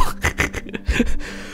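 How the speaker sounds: a burst of real breathy laughter mid-scene; breaking into laughter. Real, not acted. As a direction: crack up at what you just saw. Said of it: "Sorry, it's just that".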